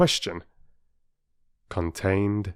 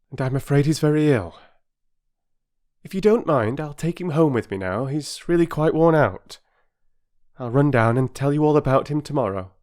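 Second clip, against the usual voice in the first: higher, gently reassuring mother; kind mother voice; 7 semitones higher, 3 dB louder than usual. A narrator's reading. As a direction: speak as a caring mother.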